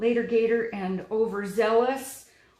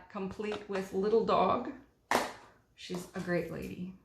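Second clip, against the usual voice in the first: ordinary talk, nothing marked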